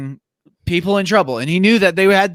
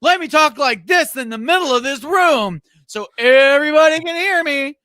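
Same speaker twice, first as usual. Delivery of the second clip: mock loud talker; 11 semitones higher, 4 dB louder than usual. Mimicking Ned blurting a secret across a full hallway, mock loud. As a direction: exaggerate being way too loud for everyone to hear. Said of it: "way too loud".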